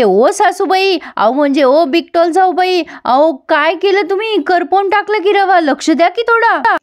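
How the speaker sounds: sing-song jab at the sister-in-law; high-pitched syrupy ribbing; mock sweet taunting.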